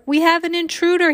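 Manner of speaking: high, loud urgent alarm; shouting. A narrator's reading. Security guard warns Missus Stoker of danger, shouting. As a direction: shout the warning at her. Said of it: "he shouted at her".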